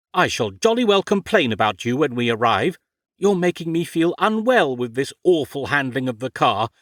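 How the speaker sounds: building outrage, posh protest; indignant arguing. Acted. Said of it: "argued Ophelia".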